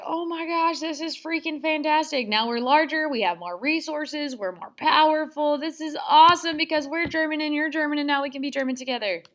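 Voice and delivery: mock elation. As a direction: gush as an overjoyed new partner. Acting delighted Austria.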